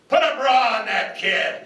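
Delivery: high, hammed-up yell from the sideline; mock coach yell. Coach shouting across the field at a missed tackle, mock yell. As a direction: bark it like a coach mocking a kid. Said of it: "the coach yells over".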